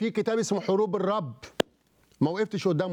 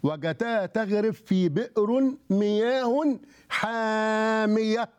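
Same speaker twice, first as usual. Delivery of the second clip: put-on slow, solemn recitation of the old text, pitched up; reciting a scripture quote; 4 semitones higher, much slower than usual. TV host quoting one tradition in a slow, solemn recitation. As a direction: intone it like reading from a holy book. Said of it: "الأقوال بتقول إيه".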